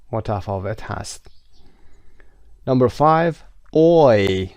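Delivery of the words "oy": OY said loud and long, gliding from o to i; diphthong oy. Shown as a demonstration. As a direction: stretch "oy" into one loud glide. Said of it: "Oy diphthong هست از اصوات مرکب".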